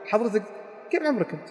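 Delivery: a touch of scorn; mild contempt. Shown as a demonstration. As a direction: belittle the listener lightly. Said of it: "احتقار واستهزاء".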